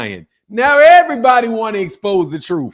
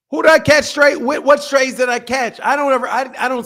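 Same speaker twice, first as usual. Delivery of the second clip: indignant; 6 semitones higher than usual. Real, not acted. Indignant about catching strays.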